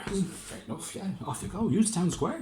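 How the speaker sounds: very high mayor voice; Lord Mayor. Acted. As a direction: dismiss him in a high voice. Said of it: "said the Lord Mayor".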